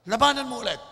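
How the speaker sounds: father pushing his son to fight again, loud; stern loud order. Retold or imitated. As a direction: order the boy back into the fight.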